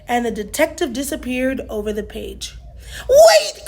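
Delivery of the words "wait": high, loud call; cry out. A narrator's reading. Mabel calling after the vanishing detective, loud and high. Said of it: "cried Mabel".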